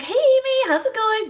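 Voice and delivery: answering the phone all sunshine; very chipper. Retold or imitated. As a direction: sing out a cheery hello to a customer. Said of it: "Very chipper, very chipper".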